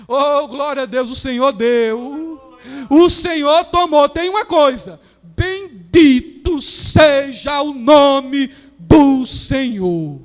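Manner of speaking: slow, loud, drawn-out praise; Job's praise. Retold or imitated. Job after losing everything, slow loud praise. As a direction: proclaim each word slowly. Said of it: "ele disse assim".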